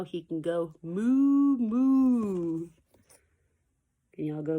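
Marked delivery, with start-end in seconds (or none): 0.0-2.7 slow, loud drawn-out moo; cow moo. A narrator's reading. Teacher reading Dr. Seuss to kids, slow loud moo. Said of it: "He can sound like a cow".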